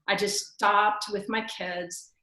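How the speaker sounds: ordinary delivery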